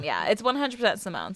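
normal talking voice

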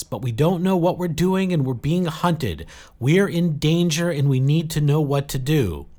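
high, projected, pressing explanation; urgent appeal. A narrator's reading. Jill telling Saradwan they are being hunted, urgent. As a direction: press the case loudly into the radio. Pleading for help over the radio.

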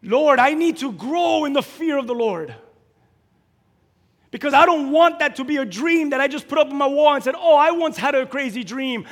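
high, loud sermon climax; loud revival preaching; preaching at full force. Real, not acted. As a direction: preach it out with fire.

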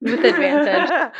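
slow, laughing repeat. Real, not acted. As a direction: giggle through the words. Laughing at the roll.

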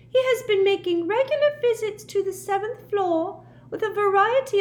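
a little elf piping up with his report; house-elf Dobby. A narrator's reading. Dobby eagerly reports Malfoy's movements to Harry, squeaky.

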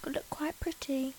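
breathy, slow whisper through the teeth; Keira Knightley impression. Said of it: "You sound a bit like Princess Diana".

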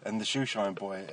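usual speaking voice

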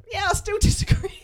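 laughing through it; high laughing protest; laughing disagreement. Retold or imitated. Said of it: "And I was like".

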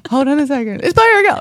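high, loud, insistent, over and over; frantic repeated asking. Retold or imitated. Mother asking her husband about the baby, frantic, high and loud. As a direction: demand an answer again and again. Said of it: "mother still asking sex".

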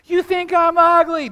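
mock-wounded wife asking if he thinks she's ugly; hurt wife. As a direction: squeak it out as the offended wife. Wife voiced in a quarrel.

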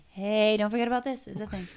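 high opening, dropping away; mock casual reminder. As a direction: toss it out as a sing-out reminder. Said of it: "it was just like".

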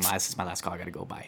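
low, hushed, hurried-off goodbye; very quiet. Retold or imitated. Recruit's last call to his mom, retold very quietly. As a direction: drop the voice almost to nothing, imitating the call. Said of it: "I just said it really quietly".

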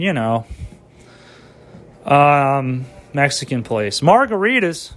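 usual speaking voice

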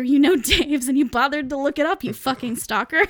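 loud, high laughing jab. Real, not acted. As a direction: laugh through the insult. Laughs mocking own writing.

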